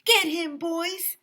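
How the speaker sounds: high, loud order; villain yelling at his goons, way up; shouted command. A narrator's reading. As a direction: sic them on him. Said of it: "shouted Doctor Carbles".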